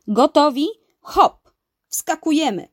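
loud, high rallying call; uncle's lively command to jump. A narrator's reading. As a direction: shout the crew overboard. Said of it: "zawołał wuj Urwisa".

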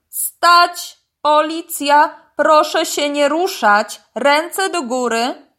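loud, high, commanding police shout; booming megaphone order. A narrator's reading. Police crocodile halts the suspects by megaphone, booming. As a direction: call out orders as through a megaphone. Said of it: "Przez megafon ktoś odezwał się donośnym głosem".

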